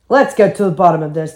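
quick, lightly growled resolve; a touch of gruff bear, fast; Daddy Bear growl. A narrator's reading. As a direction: growl briskly as a father bear. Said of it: "Daddy Bear growled".

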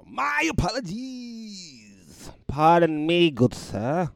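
high, drawn-out grand apology; mock-formal apology. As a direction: proclaim a theatrical apology to a gentleman. Mock-formal apology after being corrected.

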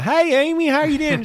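high, loud West Texas greeting; West Texas accent. Retold or imitated. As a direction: holler hello in an exaggerated West Texas twang. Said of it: "she's got that West Texas".

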